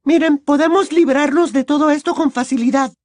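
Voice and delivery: put-on upbeat girl voice; cheerful girl voice. A narrator's reading. Ginny continues cheerfully.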